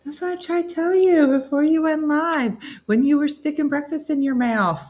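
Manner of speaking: high, loud indignant dog voice; complaining dog. Acted. Dog complains to owner who is blocking her, indignant. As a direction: whine in protest as the dog, pitched well up. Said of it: "She's like".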